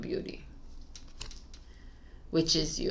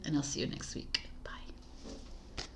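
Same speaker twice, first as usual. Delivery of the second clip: whisper; 5 semitones lower, 15 dB quieter than usual. Real, not acted. Signing off the reading.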